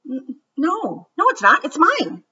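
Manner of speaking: put-on flustered kid caught off guard; startled denial. Startled Ruthie denies it to Martin, stammering. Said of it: "Ruthie was startled".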